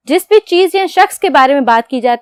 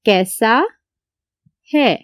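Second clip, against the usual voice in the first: dictation; 4 semitones lower, slower than usual. Shown as a demonstration. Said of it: "जैसे ही मुजक्कर आएगा".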